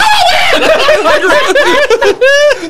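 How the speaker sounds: shrieking laugh; high burst of laughter. Real, not acted.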